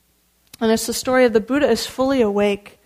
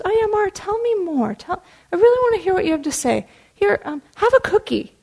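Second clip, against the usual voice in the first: warmly humoring; 9 semitones higher than usual. Acted. Buddha warmly humoring Mara.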